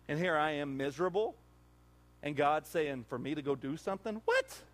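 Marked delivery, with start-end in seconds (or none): 4.2-4.6 sudden much higher squeak; incredulous what. Retold or imitated. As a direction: react in disbelief. Incredulous reaction to God.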